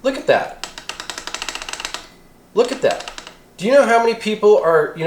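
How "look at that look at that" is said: ordinary delivery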